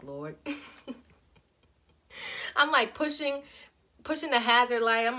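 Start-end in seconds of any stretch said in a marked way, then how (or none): 0.0-0.4 quiet prayer. Retold or imitated.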